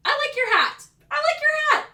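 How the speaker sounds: high, perky sing-out compliment; bubbly, demonstrative praise; chirpy compliment. Shown as a demonstration. Demonstrates simple compliment, admits sounds silly.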